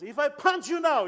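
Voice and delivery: loud, high mock threat in an Angan accent; powerful Angan accent. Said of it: "in a powerful Angan accent".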